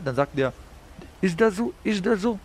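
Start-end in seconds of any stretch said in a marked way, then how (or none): 1.2-2.4 pitched way up, taunting and cocky; mocking challenge. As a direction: throw it back at him like a dare, much higher. Guy challenges him before shooting.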